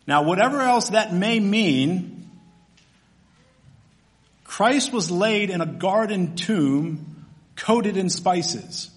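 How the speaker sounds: ordinary delivery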